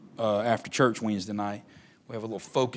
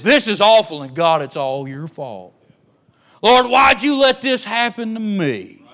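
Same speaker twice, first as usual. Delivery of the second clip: high, loud whiny grumble; loud, high blaming whine; loud grumbling complaint; 9 semitones higher, 12 dB louder than usual. Acted. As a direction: grumble at God, blaming him. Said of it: "Grumbling is".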